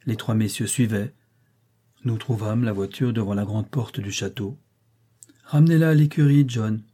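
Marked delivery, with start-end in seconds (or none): 5.4-6.8 cold order, raised at the start; cold command. A narrator's reading. Rochester sends the carriage back to the stable, coldly. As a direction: coldly order a servant, dropping on the name. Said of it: "dit froidement monsieur Rochester".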